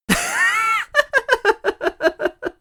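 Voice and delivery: very high shrieking laugh; wild cackling laugh. Acted. Toad laughing at the robot, high cackle. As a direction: howl with laughter.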